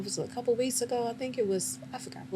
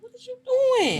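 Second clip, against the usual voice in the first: high, loud yell at another driver; mock outrage at a bad driver; road rage yell; 7 dB louder, slower than usual. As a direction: snap at the car ahead in road rage. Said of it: "cussing them people out".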